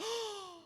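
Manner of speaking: a sudden high gasp of awe; quick mock gasp of shock; sharp high gasp. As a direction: gasp in amazement. People react to Chico's.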